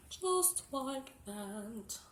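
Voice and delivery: put-on high, drawn-out British accent; playful British accent. Demonstrating herbs to viewers, playful British accent. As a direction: stretch it out in mock British. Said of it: "Don't know why I'm talking British".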